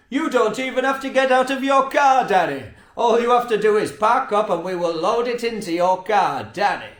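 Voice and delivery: mock cheery text voice. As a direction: ham up the shop's chirpy promise. Reading Tesco's text in mock voice.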